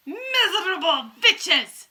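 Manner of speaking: slow, high, loud, strange witch voice; Grand High Witch yelling. A narrator's reading. Witch berating her lazy coven, yelling. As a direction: spit it at them like a furious witch. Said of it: "she yelled".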